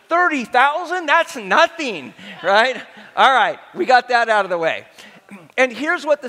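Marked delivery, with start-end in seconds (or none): 0.1-2.1 scoffing guys. Acted. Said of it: "And the guys are thinking".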